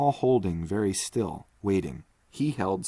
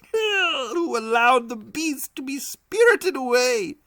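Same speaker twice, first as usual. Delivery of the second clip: high, laughing voice, slowing at the end; helpless laughter; 6 dB louder than usual. Acted. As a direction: giggle through the whole idea. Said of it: "shaken by a hilarity".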